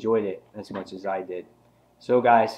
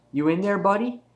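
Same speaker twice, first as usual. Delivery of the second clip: higher-pitched mock questioning of a victim; lightly acted taunt; 10 semitones higher, 4 dB louder than usual. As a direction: lightly put on a villain's raised voice. Acting out villain's taunting question.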